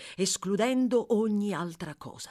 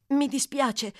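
higher-pitched, mildly frightened apology; frightened stammer; 6 semitones higher, 4 dB louder, slower than usual. A narrator's reading. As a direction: apologise as a confused, scared boy. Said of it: "balbettò Oliver, confuso e spaventato". (scuse balbettate, un po' spaventate)

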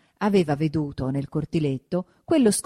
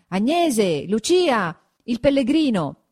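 higher, swooping hail; calling names; 6 semitones higher, 4 dB louder, slower than usual. A narrator's reading. Villagers searching the house for the women, raised calls. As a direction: call into an empty house. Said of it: "Si chiama".

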